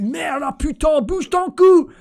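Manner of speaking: shouted French curses. Shown as a demonstration. Host showing off French swearing on stream, loud and high yelling. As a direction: bellow merde and putain like a furious Frenchman. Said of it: "we can say all those things".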